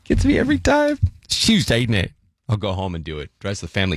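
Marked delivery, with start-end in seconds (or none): 1.2-2.1 punchy mock-British "Chewsday, innit"; British accent. Shown as a demonstration. Host repeats the favourite Britishism, playful British accent. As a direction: run it together in a cheeky British accent. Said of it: "You have to say them properly".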